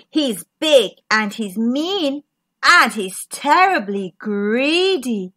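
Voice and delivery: low, measured storybook reading; story narration. Acted. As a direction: draw out the menace for young listeners. Reads a picture book.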